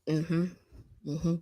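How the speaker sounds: ordinary talk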